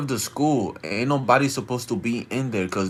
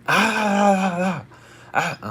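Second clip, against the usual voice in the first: long, high mimicked wail of a yell; imitated crazed shout; 10 semitones higher than usual. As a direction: hold a high wordless mock scream. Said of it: "He's, like, shouting".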